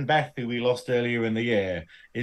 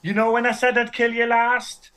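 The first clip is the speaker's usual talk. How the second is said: high-pitched Brummie action hero; Birmingham accent; 10 semitones higher, 3 dB louder than usual. Acted. Redubbed action hero taunting his victim, Birmingham accent. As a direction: put on a comic high Brummie voice. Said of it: "Arnold Schwarzenegger films and a Birmingham accent".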